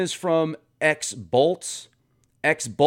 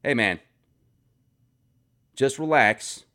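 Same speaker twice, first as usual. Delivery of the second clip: normal talking voice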